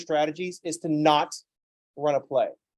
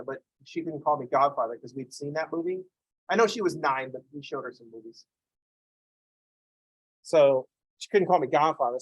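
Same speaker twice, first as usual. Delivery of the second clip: usual speaking voice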